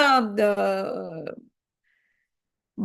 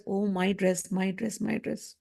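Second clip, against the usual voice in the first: put-on soft, lower whine of a small child fading away; lightly voiced five-year-old clinging to his dress; child's fond whine; 5 semitones lower, 8 dB quieter, slower than usual. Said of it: "he loves some dress".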